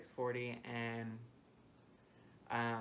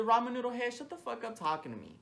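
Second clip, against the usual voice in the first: loud, higher, fed-up brush-off; mock angry shout; 11 semitones higher, 7 dB louder than usual. Telling off the manager, a loud fed-up shout. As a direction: bark the nickname, then dismiss him. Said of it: "I said".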